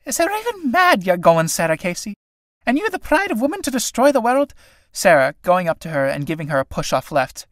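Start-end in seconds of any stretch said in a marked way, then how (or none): 0.0-4.5 terrified. Acted. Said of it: "looking at her ... in terror".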